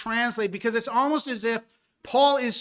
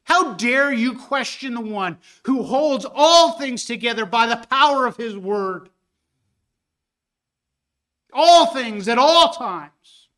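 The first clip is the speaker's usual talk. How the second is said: loud, higher, rising sermon rebuke; full-force preaching; 4 semitones higher, 6 dB louder than usual. Acted. Preacher rebuking man for judging God, full force. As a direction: thunder it from the pulpit.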